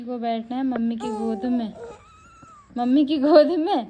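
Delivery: loud playful mock complaint; loud teasing. Real, not acted. Baby fussing to be held.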